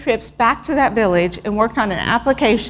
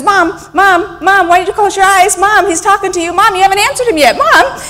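put-on high, loud, insistent child voice; imitated kid interrupting over and over; pestering little boy; 8 semitones higher, 5 dB louder than usual. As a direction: nag Mom for attention, rising in urgency. Voices pestering child calling Mom.

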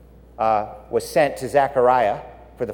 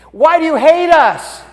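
high, much louder anguished cry; desperate cry to God; 11 dB louder than usual. Retold or imitated. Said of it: "Zechariah and Elizabeth were like".

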